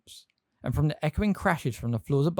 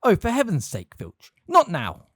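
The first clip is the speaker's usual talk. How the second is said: exasperated; 8 semitones higher, 5 dB louder than usual. A narrator's reading. McGonagall exasperated at Filch.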